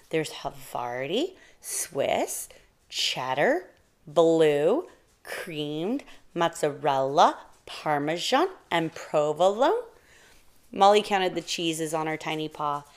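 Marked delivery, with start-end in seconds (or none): 0.1-9.8 each cheese swooping up at the end; rising list. A narrator's reading. Mouse counting cheeses on her paw, sing-song rising list. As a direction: lift "Havarti" through "provolone" each on a long rise.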